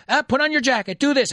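high nagging mum voice; mock mother nagging her child; fussing mother. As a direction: fuss like an overbearing mother.

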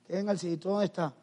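ordinary delivery

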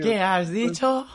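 high, loud, slow holler; calling out. Retold or imitated. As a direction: shout it to someone who can't be heard. Re-enacting shout to unheard neighbour. (pregunta a gritos, aguda y alargada)

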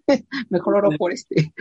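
laughing through his own lazy excuse. Retold or imitated. Retelling his excuse to the pastor, laughing. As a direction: chuckle it out, amused at yourself.

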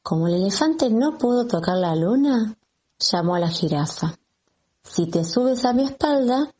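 plain reading voice

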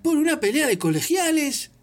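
urgent, earnest plea; Lupin's voice. Acted. Lupin pleads.

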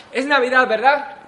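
loud, insistent cheer at a stranger; pushy greeting. Acted. Coach stops a lady on the street, loud insistent cheer. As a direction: corner her with holiday cheer.

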